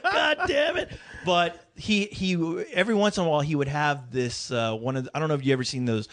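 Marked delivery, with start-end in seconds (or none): 0.0-0.9 put-on higher, yelped grumble of a slapped old man; grandpa's gripe. Grandpa slapped by his wife, high yelped grumble.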